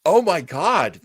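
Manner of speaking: usual speaking voice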